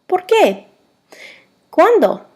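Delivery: "¿Por qué?" and "¿Cuándo?" pitched high, then falling at the end; pronunciation demo. Shown as a demonstration. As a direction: model "¿Por qué?" and "¿Cuándo?" singly, high arch dropping down. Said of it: "go over the pronunciations".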